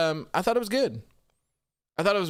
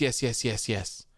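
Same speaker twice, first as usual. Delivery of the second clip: normal talking voice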